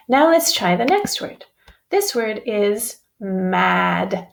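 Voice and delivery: ordinary talk, nothing marked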